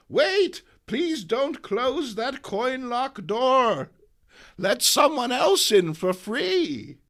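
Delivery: high, loud urgent granny; high old woman pleading for free entry; old lady pleading. Acted. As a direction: call out to stop the door closing. May pleads at lock door.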